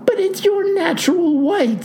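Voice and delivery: very high, alarmed protest; panicked. A narrator's reading. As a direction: let panic send the pitch way up. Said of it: "says the panicked postal clerk".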